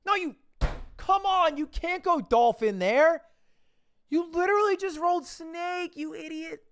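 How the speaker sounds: high, loud exasperated whine; mock outrage. Mock outburst at game play.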